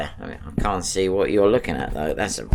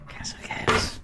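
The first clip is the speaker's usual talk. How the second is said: whispering; 6 semitones lower, 7 dB quieter than usual. Acted. Softer repeat.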